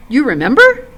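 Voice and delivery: astonished. A narrator's reading. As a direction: blurt it out in amazement. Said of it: "speaking his thought aloud in his astonishment".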